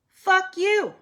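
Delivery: high, sassy fuck you with attitude; sassy dismissal. Acted. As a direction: flick them away with sass. Sassy dismissal of those who don't belong.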